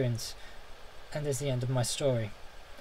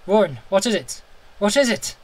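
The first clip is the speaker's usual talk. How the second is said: loud and high, frantic repeats; terrified questions; 6 semitones higher, 9 dB louder than usual. A narrator's reading. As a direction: demand an answer in panic. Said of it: "Terrified, I could repeat".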